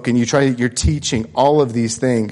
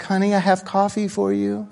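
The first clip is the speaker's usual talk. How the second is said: soft, higher, gentle wake-up offer; mock-sweet voice of his wife; wife gently waking him with coffee, sweetly; imitating sweet wife; 7 semitones higher, 5 dB quieter than usual. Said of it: "in the sweetest way".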